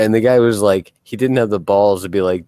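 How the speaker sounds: ordinary delivery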